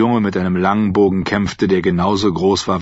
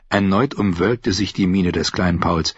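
ordinary talk, nothing marked

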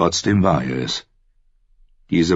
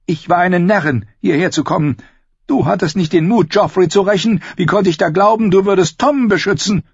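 high, strident woman's tirade; furious; 5 dB louder than usual. A narrator's reading. Sister accuses brother of cowardice, furious. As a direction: berate him in a raised, angry female voice. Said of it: "Dann trat Wut an ihre Stelle".

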